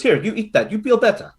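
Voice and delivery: a hint of a lola pressing food on you; Lola Carly. Lola Carly offering rice cake to comfort, lightly voiced. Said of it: "and she goes".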